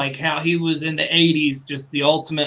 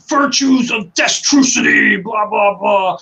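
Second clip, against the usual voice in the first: loud, high-pitched mock wrestling promo; Ultimate Warrior; 6 semitones higher, 5 dB louder than usual. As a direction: shout it like a ranting madman wrestler. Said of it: "gotta mention the Ultimate Warrior".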